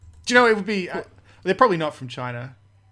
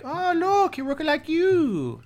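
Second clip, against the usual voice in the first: much higher, slow put-on Chinese accent; hammed-up Chinese man pointing out a likeness; comic Chinese accent impression; mock Chinese man; 11 semitones higher, slower than usual. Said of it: "he was like".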